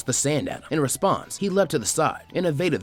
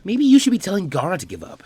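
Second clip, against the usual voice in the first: sharp retort; 8 semitones higher, 6 dB louder than usual. Acted. Ino snaps back at Temari.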